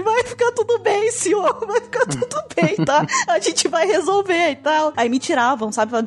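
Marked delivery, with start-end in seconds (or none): 0.0-4.9 very high, hammed-up weepy reassurance breaking into laughter; tearful comforting. Intern holds old man's arm, mock tearful comforting. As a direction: overdo the tearful consoling, slowly and high.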